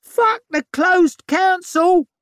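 very high, slow, loud screech; screeching child. A narrator's reading. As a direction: screech it from the crowd. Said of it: "a little girl ... screeched".

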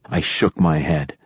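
plain reading voice

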